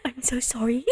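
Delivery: breathy whisper; embarrassed whisper sorry; sheepish whisper; whispered apology. Real, not acted. Apologising after squeal.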